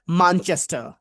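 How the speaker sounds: Manchester accent, open "aa" in "মানচেস্টার". Shown as a demonstration. Teacher mimics locals naming their city, Manchester accent. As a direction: broaden "মানচেস্টার" to maan-ches-tuh, Manchester style. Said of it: "আ, আ করে কথা বলে".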